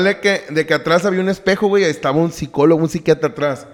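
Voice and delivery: ordinary talk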